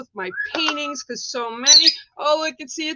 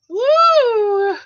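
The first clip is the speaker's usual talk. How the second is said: loud, high cheer; loud whoop; 11 semitones higher, 8 dB louder than usual. Real, not acted. Host cheers to viewers at her painting card, loud whoop. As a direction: belt out a joyful whoop.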